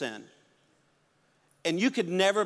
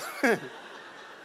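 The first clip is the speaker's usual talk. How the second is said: breathy unvoiced laugh. Real, not acted. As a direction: laugh at yourself under your breath. Laughs at his own story.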